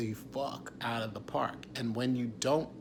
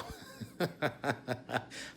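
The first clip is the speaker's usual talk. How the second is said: breathy laugh; 5 dB quieter than usual. Real, not acted. Laughing at his own thought.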